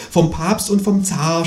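high, slow ghostly moan; groaning. A narrator's reading. Persecuted ghost naming its hunters, groaning. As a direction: moan out who is after you. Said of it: "stöhnt das Gespenst".

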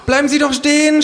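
high, loud yell after someone fleeing; high-pitched pursuer's cry; shouting. A narrator's reading. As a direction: shout it shrilly as you give chase. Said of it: "schreit sie".

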